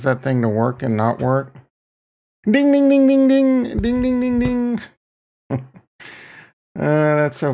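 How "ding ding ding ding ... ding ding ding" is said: high sing-song ding ding; bell ding imitation. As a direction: ring out like a bell. Mimicking a bell sound.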